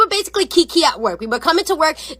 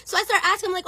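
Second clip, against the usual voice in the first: ordinary delivery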